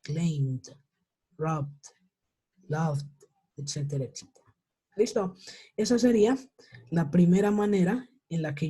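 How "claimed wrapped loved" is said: "Claimed, wrapped, loved" each in one syllable; D endings. Shown as a demonstration. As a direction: say "Claimed, wrapped, loved" slowly apart, silent E before the final consonant. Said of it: "Terminan en una D".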